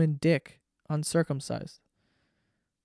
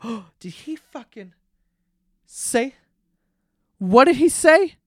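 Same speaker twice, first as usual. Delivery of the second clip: gasping, high mock disbelief, building louder; mock shock; 8 semitones higher, 7 dB louder than usual. Host imitating everyone reacting to shock value, mock shock. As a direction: act scandalized, rising to a loud high finish.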